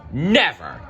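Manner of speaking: loud defiant never; exclaimed. Acted. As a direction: refuse him outright. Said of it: "Belle exclaimed".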